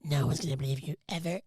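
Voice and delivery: a hint of an evil sci-fi alien; lightly voiced creepy alien. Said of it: "in the most evil voice ever".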